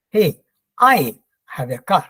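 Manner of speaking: "I" stressed and drawn out. Shown as a demonstration. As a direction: lean on the word "I", slowly, then drop through the rest. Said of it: "That means he has the car".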